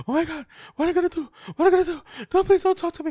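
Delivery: high, fast, hammed-up panic; mock panic. Introvert dreading a stranger's approach, high fast mock panic. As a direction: gabble it like a cornered introvert. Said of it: "all those thoughts are just coming".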